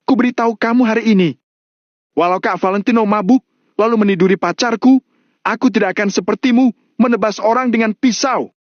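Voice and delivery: high, fast furious tirade; heated high-pitched outburst; angry roar. A narrator's reading. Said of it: "membelalakan matanya sambil meraung".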